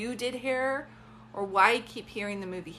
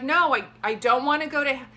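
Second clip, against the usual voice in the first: loud, higher mock New Jersey grandma; bad New Jersey accent; 4 semitones higher, 8 dB louder than usual. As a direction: put on a hammed-up New York-area accent. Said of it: "terrible accent, New Jersey".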